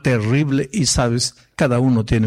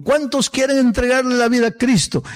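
raised, higher-pitched pulpit invitation; preacher's altar call; 10 semitones higher than usual. Acted. Preacher to the congregation at sermon's end, altar call. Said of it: "al final del sermón digo".

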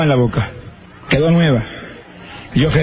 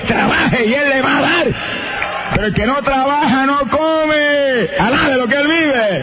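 full-force preaching; 6 semitones higher than usual. Real, not acted. Preacher urging lazy believers to rise and work, full-force preaching. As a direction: scold the congregation into action, building higher to the end.

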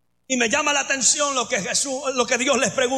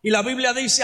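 ordinary talk, nothing marked

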